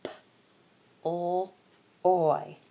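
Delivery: P, o, oy said apart; sounding out ploy. Shown as a demonstration.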